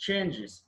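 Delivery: చేంజెస్ as "change-iz"; "-iz" ending. Shown as a demonstration. Teacher correcting learners' plural, చేంజెస్ as "change-iz". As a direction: end చేంజెస్ on short i and z. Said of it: "ఇజ్".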